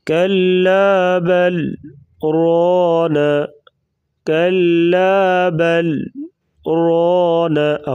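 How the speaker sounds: brief voice stop after बल before रोना, breath held on; saktah pause. Shown as a demonstration. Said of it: "आवाज़ बंद करेंगे".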